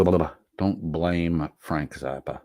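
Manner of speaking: ordinary talk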